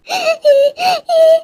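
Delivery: a wicked cackle of delight; gloating laugh. Acted. Mother-in-law gloats, bahu leaving. (खुशी से ज़ोर की ठहाकेदार हँसी)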